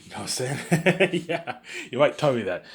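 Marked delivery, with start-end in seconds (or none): 0.6-1.2 brief real chuckle; quick amused laugh; short laugh. Real, not acted. Laughs at his own retold praise.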